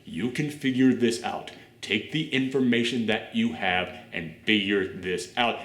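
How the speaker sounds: hint of a composed explainer, quietly insistent; calm British alter ego. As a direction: imitate him reasoning it out, low and measured. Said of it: "British version of him pops up and he goes".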